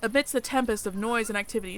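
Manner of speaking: usual speaking voice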